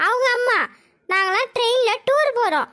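ordinary delivery

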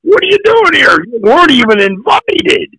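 high-pitched retold disbelief; incredulous challenge. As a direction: voice an announcer amazed to see you. Retold announcer challenging him.

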